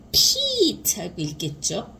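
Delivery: ordinary talk, nothing marked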